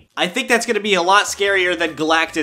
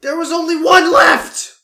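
higher, louder dismayed yell; anguished shout; 5 dB louder, slower than usual. Real, not acted. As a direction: wail over the loss. Dying with one enemy left.

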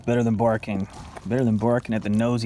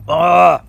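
sharp loud groan of annoyance; frustrated outburst; 4 semitones higher, 10 dB louder than usual. Real, not acted. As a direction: blurt out a loud ah at the miss. Reacting to wrong answer.

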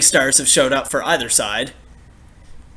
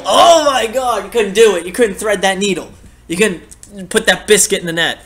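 frustrated outburst; 5 semitones higher, 4 dB louder than usual. Real, not acted. Late chance missed, frustrated outburst.

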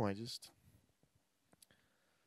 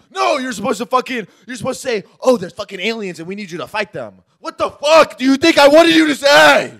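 high, loud angry rant; 10 semitones higher, 16 dB louder than usual. Retold or imitated. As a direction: rage at a clueless actor. Ranting director voice.

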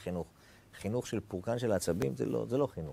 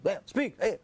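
a mild mock roar to stop a wild child; 7 semitones higher than usual. Parent stopping a child gone wild, mild mock roar. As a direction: bark it out half-seriously, just a hint of a yell. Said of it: "אז עושים כזה, או שאגה".

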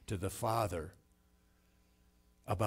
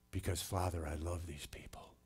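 soft, low, fading almost to a whisper; hushed, loving plea; tender prayer; 3 semitones lower, 6 dB quieter than usual. Acted. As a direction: confide it quietly to the Father. Voicing Christ's prayer to the Father.

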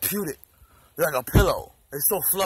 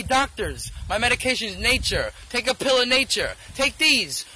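ordinary delivery

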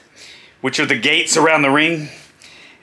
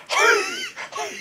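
high, thin wheezing gasps; winded gasps; 7 dB quieter than usual. Retold or imitated. Showing how it is with breath knocked out, acted gasping. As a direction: imitate fighting for air. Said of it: "You're struggling just to breathe".

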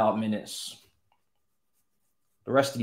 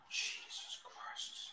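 despairing whisper; 20 dB quieter, much slower than usual. Real, not acted. Despair after conceding.

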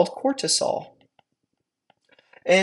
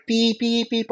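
mock alarm beep, pitched higher; imitated alarm beeping; 5 semitones higher, slower than usual. Lecturer miming the body's alarm to students, mock beeping. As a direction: beep like a panicking alarm going off. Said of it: "you're like".

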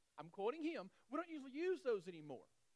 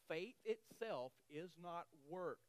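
slow emphatic reading; 4 semitones lower, slower than usual. Shown as a demonstration. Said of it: "grab this".

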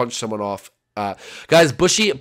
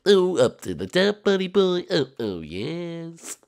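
hammed-up voice, pitched up at first; cringy put-on voice; 4 semitones higher, slower than usual. Gamer using his old livestream voice, cringy put-on voice. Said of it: "Let's not use that voice".

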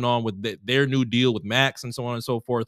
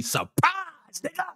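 mock surprise yell; 4 dB quieter, slower than usual. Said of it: "every time I see that mask, I just see".

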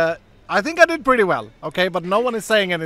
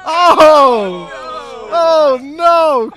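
high, drawn-out wail of dismay; loud dismay; 7 semitones higher, 10 dB louder, much slower than usual. Real, not acted. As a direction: cry out "oh no" in real dismay. Dismayed reaction to the reveal.

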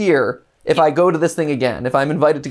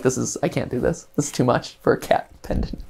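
soft, half-whispered giving up; quiet resigned murmur; 4 semitones lower, 7 dB quieter than usual. Retold or imitated. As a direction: mutter it to yourself, defeated. Bowing out of bidding war.

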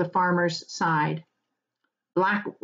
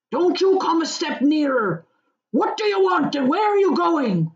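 much higher, louder rustic warning; defiant farmer; 9 semitones higher, 4 dB louder than usual. Acted. Farmer warns off dark rider, defiant shout. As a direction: stand your ground and bark at him.

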